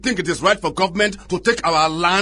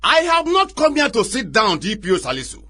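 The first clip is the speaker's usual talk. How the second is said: raised, heated protest; angry refusal; 7 semitones higher than usual. Acted. Chief scolding the DPO over his wife's burnt store, heated. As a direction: refuse the seat and vent your anger.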